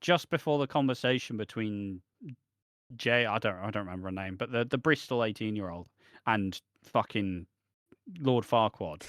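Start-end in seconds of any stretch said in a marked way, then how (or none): none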